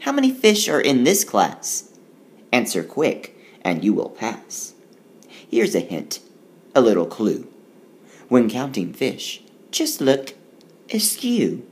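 lively, sing-song riddle read aloud; poem reading. Acted. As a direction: read it like a playful rhyme for kids. Reads rhyming riddle poem aloud.

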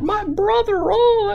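very high hammed-up wail; mock crying. Kid faking tears to save the day, mock wailing. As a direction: wail it like a fake tearful kid. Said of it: "starts crying about it".

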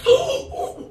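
high, dramatic gasp; shocked gasp. Acted. Vlogger learns of being caught on camera, shocked gasp.

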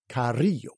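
Carrillo with rolled double R and Y for double L; Spanish pronunciation. Shown as a demonstration. Teaching a Spanish name on the job, careful Spanish pronunciation. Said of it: "this has a double R and a double L".

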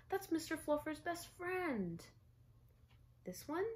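put-on a small boy horrified at losing his bunny; dismayed little boy.

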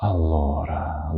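slow, drawn-out pensive delivery; Professor Snape. Shown as a demonstration. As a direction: draw it out, deep in thought. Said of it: "задумчиво, як професор Снейп". (задумливо)

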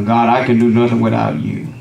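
ordinary talk, nothing marked

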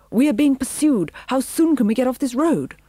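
high, loud, urgent plea; barked. A narrator's reading. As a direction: bark it out as a desperate appeal. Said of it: "barked Pongo".

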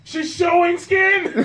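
high, loud, slow laughing cry; hammed-up sheriff outraged at bare skin; mock outraged cowboy.

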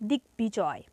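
দিগ্বিজয় said "dik-bijoy", b sounded; b kept. Shown as a demonstration. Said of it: "উচ্চারণ বজায় থাকবে".

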